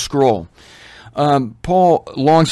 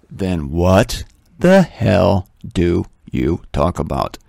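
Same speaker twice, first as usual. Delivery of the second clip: much lower, slow deadpan disbelief; incredulous retort; 9 semitones lower, slower than usual. Retold or imitated. Preacher retelling his incredulous retort, low and slow. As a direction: drop the voice, dry and slow.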